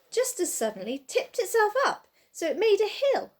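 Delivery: ordinary delivery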